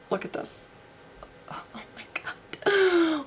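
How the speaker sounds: slow, breathless whisper of wonder; awed whisper. Real, not acted. Whispering to viewers in awe of the shadow. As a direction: hush as if it might break.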